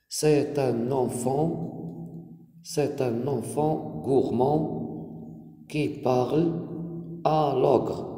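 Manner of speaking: very slow, drawn-out dictation; measured word groups, spaced for copying. Shown as a demonstration. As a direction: dictate for pupils writing it down. Dictating the written answer to pupils.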